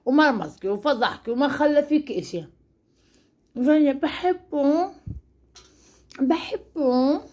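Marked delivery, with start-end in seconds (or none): 3.6-7.2 slow, drawn-out lovestruck mockery; mock lovesick sigh. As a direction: stretch it out like a besotted fool. Mocking lovesick singer. (بصوت عاشقة ساخرة ومتمهلة)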